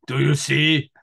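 slow, eerie, altered villain voice; creepy villain. Retold or imitated. Quoting the Red Dragon killer, slow and eerie. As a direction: draw the question out, unsettling.

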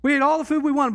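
high, loud preacher's cry; preaching at full force. Acted. Pastor rousing the congregation about the Israelites, loud preaching. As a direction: lift the congregation with a ringing call.

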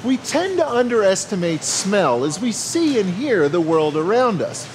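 ordinary talk

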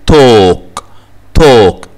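توك with A said as long O; long O, silent L. Shown as a demonstration. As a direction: stretch the O in توك, no L. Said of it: "ال A بتتنطق O". (توك بمد الـ O ومن غير L)

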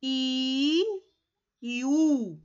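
"I y U" with each vowel held long; closed vowels sounded out. Shown as a demonstration. Teacher showing pupils the small mouth shape, vowels held long. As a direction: draw out "I", then "U", as separate closed vowels. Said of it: "¿Sí ve la boquita?".

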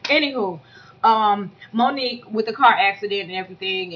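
normal talking voice

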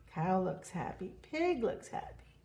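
plain reading voice

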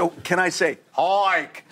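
HIKE drawn out high in broad New England vowels; New England accent. Retold or imitated. Extra slips accent back into the line, New England accent. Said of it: "this story takes place in New England".

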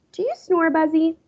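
put-on high, curious child voice; little boy. A narrator's reading. Fudge asking Buzzy Senior about snoring. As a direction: ask it out of nowhere.

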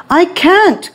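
high desperate cry; cried out. A narrator's reading. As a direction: plead in alarm. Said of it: "cried Chang".

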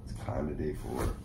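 low, rough just-woke-up morning voice. Real, not acted. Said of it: "This is my morning voice".

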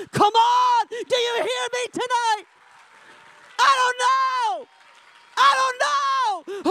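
high, loud preacher's shout; full-force preaching. Real, not acted. Preacher challenging congregation to hear him tonight, full-force shout. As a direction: cry it out to rouse the room.

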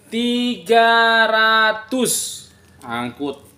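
slow, high drawn-out price call; loud cheerful hawker calls. Real, not acted. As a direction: stretch the price like a barker. Live seller calls out price.

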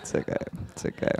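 very soft, near-whispered reassurance; hushed consoling. Acted. Comic soothes the person just roasted, hushed and consoling. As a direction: console them under your breath.

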